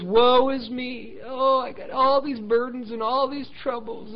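high, drawn-out self-pitying moan; mock whining. Preacher mimicking believers moaning over their burdens, mock whining. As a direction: whine it like a hammed-up martyr. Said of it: "we kinda got this attitude as".

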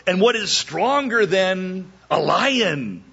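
slow, drawn-out gloating question; taunting riddle answer. Acted. Groomsmen gloating at Samson, slow and smug. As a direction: lay it out slowly, smug and triumphant. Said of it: "And they said".

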